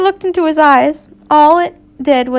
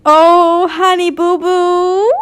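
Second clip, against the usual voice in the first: mocking coo; 5 semitones higher, much slower than usual. Mocking aside, camp voice.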